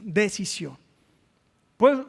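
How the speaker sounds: ordinary talk, nothing marked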